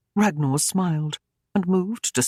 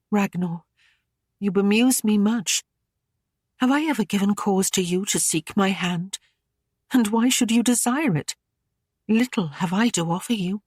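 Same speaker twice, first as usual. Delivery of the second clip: higher, puzzled and guarded lady; gently bewildered, pitched up; higher maidenly voice, confused; young lady, voiced higher; 5 semitones higher than usual. Aislinn's voice in narration.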